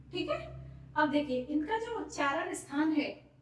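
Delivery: normal talking voice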